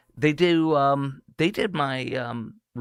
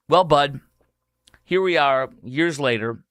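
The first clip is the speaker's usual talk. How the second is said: ordinary talk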